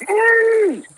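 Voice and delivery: high, loud frustrated groan; exasperated wordless outburst; frustrated grunt. Real, not acted. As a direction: let out a real high squeal of frustration.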